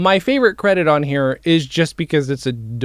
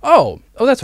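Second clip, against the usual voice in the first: sudden realization; 11 semitones higher, 6 dB louder than usual. Real, not acted. Remembers the lost question.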